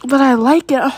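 breathy and urgent, broken by gasps and long pauses; breathless arousal. Acted. Girlfriend in an intimate moment with partner, breathless.

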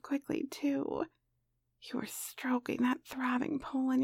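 soft unvoiced storytelling whisper; whispered narration. A narrator's reading. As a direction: whisper the story close to the mic.

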